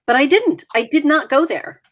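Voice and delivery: usual speaking voice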